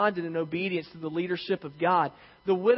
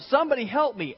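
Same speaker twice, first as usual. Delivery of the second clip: higher, louder cry for help; screaming for help; 7 semitones higher, 4 dB louder than usual. A narrator's reading. Hiker dangling over the canyon cries for help. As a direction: call out like a man hanging off a cliff. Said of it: "he's screaming for help".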